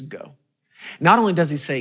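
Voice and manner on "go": a soft, hushed Go; changed tone. Retold or imitated. Saul, won over by David, softly gives his blessing, hushed. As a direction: let Saul quietly give way. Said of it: "Saul changes his whole tone and says".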